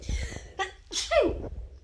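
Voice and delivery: a sudden breathy sneeze; real sneeze into the mic; sneezing. Real, not acted. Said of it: "excuse me, I'm going to sneeze".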